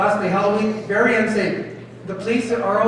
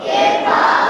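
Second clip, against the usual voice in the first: group shouting the word; 3 dB louder than usual. Real, not acted. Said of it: "Again, say kirpa".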